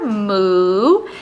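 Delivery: low, drawn-out cow moo; playful low moo. Shown as a demonstration. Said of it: "she's being a, a cow right here".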